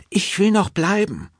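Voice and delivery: hohe, trotzige Frauenstimme; sträub dich mit hoher Stimme; quengelnde Frau. A narrator's reading. Frau sträubt sich gegen Aufbruch. (hoch und bettelnd)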